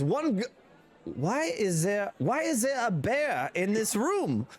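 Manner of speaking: high, slow, baffled question; surprised halfling. Acted. Halfling bidder seeing a bear in the room, high and baffled. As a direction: stop mid-bid in disbelief.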